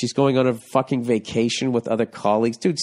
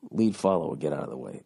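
"Lead, follow, get out of the way" muttered lower and much softer; muttered motto; 9 semitones lower, 7 dB quieter than usual. Shown as a demonstration. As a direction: throw the motto away under the breath. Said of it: "Here we go".

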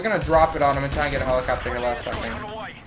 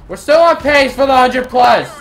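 sudden raised-voice outburst; loud shout; 7 semitones higher, 11 dB louder than usual. Real, not acted. Gamer announcing he is on pace for a hundred, loud shout. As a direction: call out loud and high.

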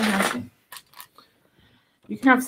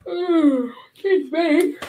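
talking through a yawn; mid-yawn, pitch jumping up; 10 semitones higher, 3 dB louder, much slower than usual. Real, not acted. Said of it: "yawning on the stream".

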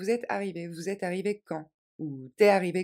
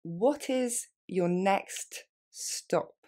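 what is your next stop, every word spelled out slowly; slow and careful for the learner; much slower than usual. Shown as a demonstration. Teacher modelling for students, very slow. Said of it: "Plus lentement".

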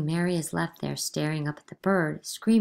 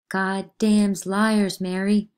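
slow accusation; 3 semitones higher, 7 dB louder, much slower than usual. Acted.

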